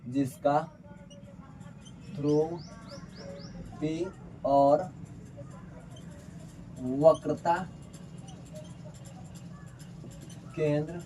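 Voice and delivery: word-by-word dictation with long pauses; drawn-out words, widely spaced; slow dictation. Real, not acted. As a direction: dictate slowly, as if writing each word out. Dictates while writing.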